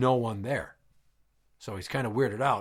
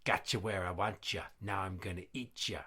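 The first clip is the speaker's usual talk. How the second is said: low, hushed ghostly threat; quiet menacing sing-out from the dark; spooky voice; 5 semitones lower, 9 dB quieter than usual. Acted. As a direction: drop it low and soft, like a voice haunting a room. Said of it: "there's a little old man sitting on the toilet".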